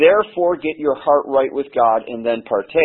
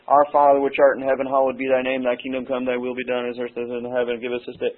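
mumbled rote recital, fading softer; rote prayer; 4 dB quieter than usual. Shown as a demonstration. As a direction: rattle it off without thinking, trailing away. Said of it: "we don't wanna just dumbly pray".

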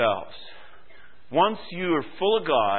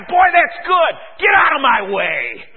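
loud mock enthusiasm turning to a shove-off; brusque dismissal; 9 semitones higher, 10 dB louder than usual. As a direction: shout them out of your way. Acted dismissive Bible student.

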